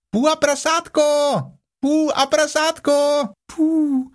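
high little kangaroo voice calling out; Roo calling. A narrator's reading. Roo calling to Pooh and Piglet, high and eager. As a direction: call down excitedly like a small child.

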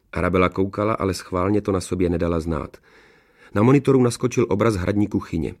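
usual speaking voice

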